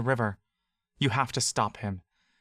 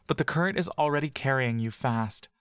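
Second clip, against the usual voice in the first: plain reading voice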